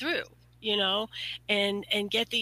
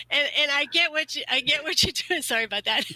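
normal talking voice

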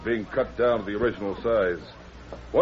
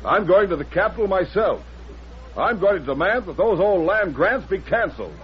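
indignant; 7 semitones higher than usual. Acted. Greenway storming off in protest.

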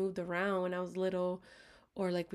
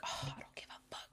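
much softer, hushed and defiant; defiant whisper; 7 semitones lower, 11 dB quieter than usual. Retold or imitated. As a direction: whisper it like a secret rebellion. Retold defiant inner thought.

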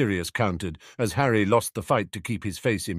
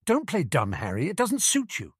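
higher-pitched reproach; sniping; 10 semitones higher than usual. A narrator's reading. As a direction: snipe with rising irritation. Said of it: "Sirius sniped".

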